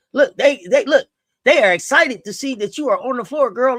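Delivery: usual speaking voice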